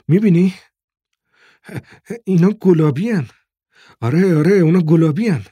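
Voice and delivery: high, loud, delighted exclaiming; excited wonder. A narrator's reading. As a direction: marvel aloud at treasured food. Father and son marvel at pears. (با ذوق و هیجان، صدای بلند و زیر)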